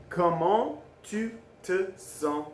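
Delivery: "Comment tu te sens" slowly, a pause between words; very slow, separated "Comment... tu... te... sens"; word-by-word demo. Shown as a demonstration. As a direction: model "Comment tu te sens" one word at a time. Teacher models phrase word by word.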